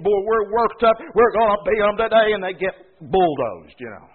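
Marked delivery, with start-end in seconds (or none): 0.0-2.3 louder, pumped-up locker-room boast; fired-up team cheer. Retold or imitated. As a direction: play the overeager team before the game. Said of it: "It's kinda like some sport team".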